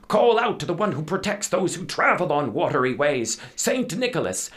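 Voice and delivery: commanding captain. Acted. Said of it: "said the captain".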